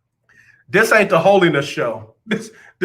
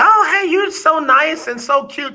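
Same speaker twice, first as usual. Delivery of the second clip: high sugary mock voice; sweet-talking folks; 11 semitones higher than usual. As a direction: imitate a gushing admirer, way up high. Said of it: "folks be like".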